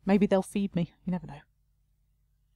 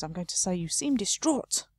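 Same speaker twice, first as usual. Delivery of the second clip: usual speaking voice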